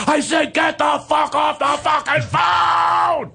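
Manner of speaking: high, drawn-out furious scream; all-out scream. Retold or imitated. Caller at breaking point screams down the phone, all-out angry scream. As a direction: scream it as loud as you can, stretching every word. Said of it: "he screams as loud as you can scream".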